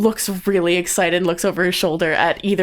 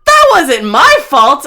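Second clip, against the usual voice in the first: loud, raised, drawn-out denial; indignant protest; 7 semitones higher, 11 dB louder, slower than usual. Acted. Sister answers her brother's reproach, loud and indignant. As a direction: protest loudly, stretching the words.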